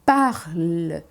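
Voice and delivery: Parle said low with a held, stronger L; strong French L. Shown as a demonstration. Teacher stresses the L in parle for students. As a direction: lean on the L in Parle. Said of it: "L um pouco mais puxado".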